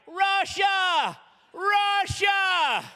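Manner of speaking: high, loud shout of "Russia!"; excited cry. Retold or imitated. Elderly missionary shouting his new goal, high excited cry. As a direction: call it out like a sudden calling.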